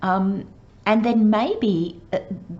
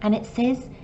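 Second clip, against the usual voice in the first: ordinary talk, nothing marked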